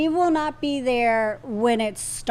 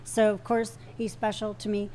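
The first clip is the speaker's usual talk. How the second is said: usual speaking voice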